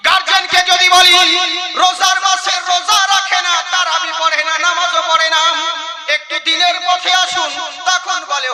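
fiery preaching. Acted. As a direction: preach it hard to the crowd. Fiery sermon passage.

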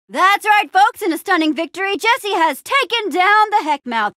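high, excited play-by-play parody; sports announcer. Pretend. Announcing Jesse's stunning victory to folks, mock commentator. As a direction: call it like a thrilled commentator. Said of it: "You said it, Bob".

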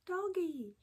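usual speaking voice

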